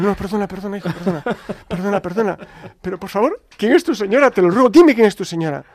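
high, hurried apology building to loud begging; retold pleading. Priest begging the delivery man, exaggerated pleading. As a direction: back off apologetically, then implore him.

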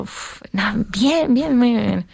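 high, put-on "fine"; forced cheerfulness. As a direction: pretend everything is fine. She hides her suffering from coworkers. (alegría forzada, fingida)

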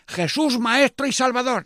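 high, loud call for the crowd to repeat; loud leader's call before repeating; leading call. Shown as a demonstration.

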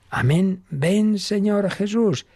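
high, slow longing plea; fervent prayer. Acted. Priest voicing the Bible's closing plea, slow and fervent. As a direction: call on him with yearning.

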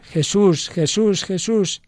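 loud cry. A narrator's reading. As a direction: call the name out to heaven. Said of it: "gritó con fuerte voz". (grito fuerte de invocación, más agudo)

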